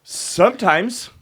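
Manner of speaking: high, slow drawn-out sometimes; teasing drawl. Real, not acted. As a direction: stretch it playfully. Pastor teasing drawn-out word.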